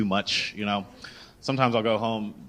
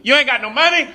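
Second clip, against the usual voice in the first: very high, loud blurt; mock loud announcement; 7 dB louder than usual. Lady behind him exposing his secret, high loud blurt. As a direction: shout it high for everyone to hear.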